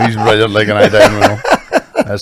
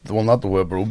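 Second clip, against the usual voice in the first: normal talking voice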